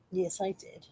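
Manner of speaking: ordinary talk, nothing marked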